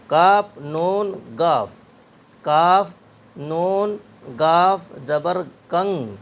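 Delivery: letters named slowly, one by one; teacher spelling کنگ letter by letter; slow spelling drill. Shown as a demonstration. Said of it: "تین حرفوں کو ملا کر کے پڑھا جاتا ہے".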